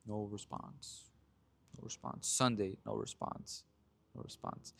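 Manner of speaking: hushed, near-whispered repetition; deadpan dejection. Real, not acted. Telling how his texts went unanswered, hushed deadpan. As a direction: drop to a soft, slow murmur.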